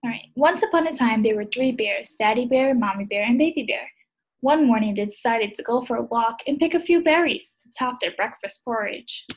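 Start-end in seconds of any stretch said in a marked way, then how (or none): none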